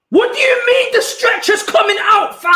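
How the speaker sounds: high, loud disbelieving yell; furious, high-pitched shout of disbelief; real outraged shout. Real, not acted. Stretcher coming onto the pitch.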